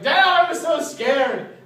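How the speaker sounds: put-on loud, high-pitched kid's cry, drawn out; retold relieved cry. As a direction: cry out to Dad at full voice, slowly. Child calling to supposed dad.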